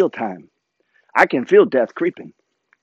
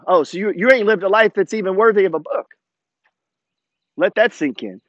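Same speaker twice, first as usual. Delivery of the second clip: normal talking voice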